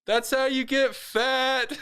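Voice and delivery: high, slow, stretched-out holler; mock yelling. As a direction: yell it after someone running away, hammed up. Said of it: "he just yells out".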